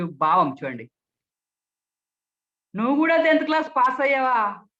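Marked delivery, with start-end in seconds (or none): 2.8-4.7 high, loud, mocking disbelief; sarcastic. Shown as a demonstration. Speech trainer mocks someone for passing tenth class, sarcastic. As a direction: jeer it, pitched up and loud. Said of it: "వ్యంగ్యంగా ఎలా మాట్లాడొచ్చు".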